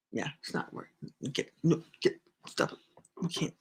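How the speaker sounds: whispered telling-off under her breath; hushed scolding. Real, not acted. Host hushes someone off-camera mid-stream, whispering. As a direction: hiss at someone off camera to stop.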